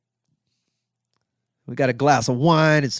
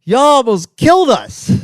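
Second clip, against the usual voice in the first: much higher and louder, playful accusing hey; excited reply; 9 semitones higher, 7 dB louder, slower than usual. Retold or imitated. Comedian answering the Marines in the hallway, loud playful call. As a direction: toss it back with mock outrage. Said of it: "And I was like".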